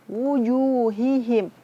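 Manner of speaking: "wujuhihim" with a short rounded "wu", not stretched. Shown as a demonstration. Teacher corrects a too-long vowel, slow and careful. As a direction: say "wujuhihim" slowly with the "wu" kept short. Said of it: "wu-nya nggak panjang".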